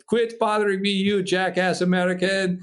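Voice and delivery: put-on high-pitched, annoyed French-accented English; imitated French accent. Frenchman picks up on a prank caller, annoyed imitated French accent. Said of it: "his English wasn't great".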